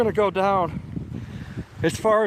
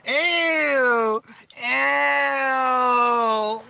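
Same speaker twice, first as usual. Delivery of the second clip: screaming at a spider; 7 semitones higher than usual. Real, not acted. Squeals in disgust.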